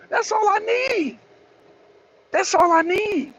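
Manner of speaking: high, drawn-out declaration; worked-up declaration. Real, not acted. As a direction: pitch it high and stretch it out, all fired up. Declares a reason to fight.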